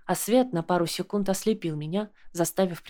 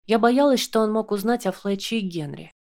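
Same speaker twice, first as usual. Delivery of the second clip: usual speaking voice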